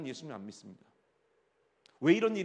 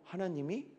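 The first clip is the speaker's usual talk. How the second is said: ordinary delivery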